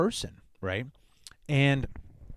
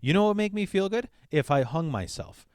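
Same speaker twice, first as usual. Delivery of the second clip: higher, chirpy mock of the mother; mocking mom; 6 semitones higher than usual. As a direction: sell it brightly like a twisted offer. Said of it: "That's how she talked to a ten-year-old".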